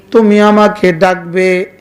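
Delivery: ordinary talk, nothing marked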